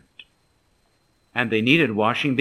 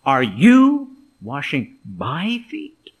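"you" and "my" punched slowly, rising; stress on you and my; 4 dB louder, slower than usual. Shown as a demonstration. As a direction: lean hard on "you", pause, then "my feet?". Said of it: "emphasis on the you and on the my".

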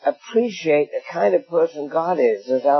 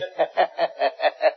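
light laugh; 2 semitones lower than usual. Real, not acted. Laugh after joke.

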